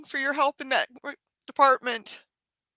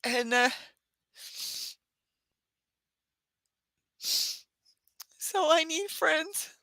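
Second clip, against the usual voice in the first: tearful; 3 semitones higher, 6 dB quieter, much slower than usual. Real, not acted. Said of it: "I have to cry now".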